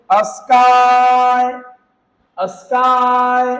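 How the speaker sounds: स्काई with a very long final "aaai". Shown as a demonstration. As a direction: hold the ending of स्काई on a level note. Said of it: "आई पढ़ते हैं".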